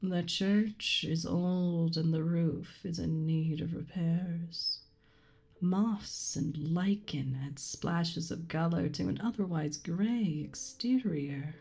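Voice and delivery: slow story narration. Acted. Reads game scene narration slowly.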